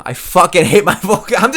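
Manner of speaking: ordinary talk, nothing marked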